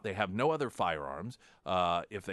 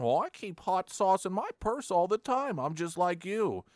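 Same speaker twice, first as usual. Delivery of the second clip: mocking Hillary; 10 semitones higher than usual. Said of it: "Whether it's Hillary Clinton".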